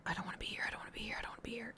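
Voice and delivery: terrified whispered repeat; frightened whisper. Retold or imitated. Scared fourteen-year-old hiding behind cover, whispering. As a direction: whisper it to yourself, scared stiff.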